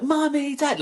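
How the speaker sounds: put-on high, delighted squeal of a small boy; excited child. Seven-year-old son greeting parents at the door, high excited squeal. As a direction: squeal it like a kid thrilled to see parents.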